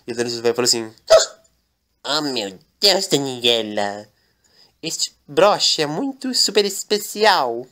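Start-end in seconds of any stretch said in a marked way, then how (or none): 2.0-7.7 put-on slow, drawn-out old man; old master slowly marveling at a brooch. Old Lorenzo speaks slowly.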